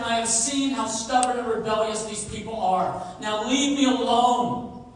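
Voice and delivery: God roaring his threat; angry God. Acted. God tells Moses to leave him alone, angry threat. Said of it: "my fierce anger can blaze".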